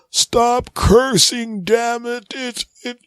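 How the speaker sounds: very high, slowed-down squeal; falling old dad. Acted. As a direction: stretch it like a slow-motion fall.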